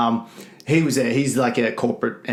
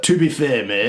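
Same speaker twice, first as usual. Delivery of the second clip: mock Northern English accent. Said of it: "in his Northern English accent".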